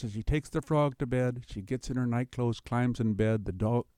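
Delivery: ordinary talk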